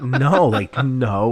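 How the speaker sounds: loud, emphatic retold "no"; mock parental scolding. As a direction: put your foot down loudly, as if to a child. Parent retells scolding child.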